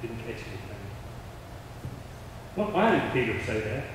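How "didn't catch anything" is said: much softer, nearly whispered; weary whisper. Acted. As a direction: breathe it out tired after a long night. Peter voiced tired objection.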